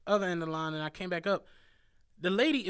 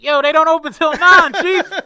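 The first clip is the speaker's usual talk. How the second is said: much louder, higher call-out to the bank line; 10 semitones higher, 18 dB louder than usual. Retold or imitated. Driver retelling yelling at people waiting outside the bank, loud call-out. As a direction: holler it across the street at the crowd. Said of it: "Not even whispering".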